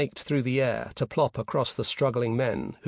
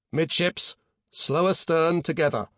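slightly raised helm order; firm command; 4 semitones higher than usual. A narrator's reading. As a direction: give a concentrated order down the voice pipe. Conning orders down voice pipe.

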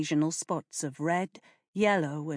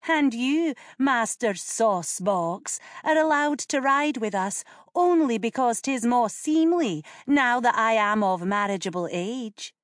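put-on high, superior young lady; haughty girl; 6 semitones higher, 4 dB louder than usual. A narrator's reading. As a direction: put her little brother in his place. Girl lording it over brother.

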